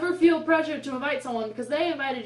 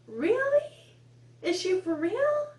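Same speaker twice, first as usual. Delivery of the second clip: high, loud disbelieving "Really?"; a sky-high, loud "Really?" of mock outrage; incredulous really; 9 semitones higher, 3 dB louder, slower than usual. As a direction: scoff at her in disbelief. Mocking the whiny girl.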